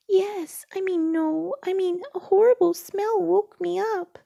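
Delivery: high, loud and breathless; a small frightened piglet blurting it out; scared Piglet voice. Acted.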